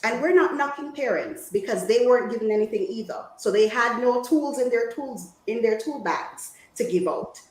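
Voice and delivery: ordinary delivery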